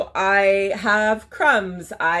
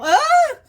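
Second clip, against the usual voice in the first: startled high yelp while tipping over; losing balance; 7 dB louder than usual. Real, not acted. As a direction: cry out as you wobble and nearly fall. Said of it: "I'm gonna fall on this chair".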